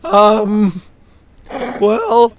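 put-on high, loud nervous teen voice; stammering awkward suitor. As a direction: squeak it out, hesitant and shy. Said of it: "give me an awkward Lux voice".

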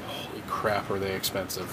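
breathy hushed exclamation. Real, not acted. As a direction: say it under your breath, amazed. Hushed aside on comic prices.

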